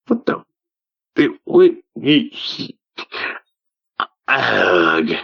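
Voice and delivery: choked, breaking off words; sputter, lost for words; stammering mock outrage; sputtering disbelief.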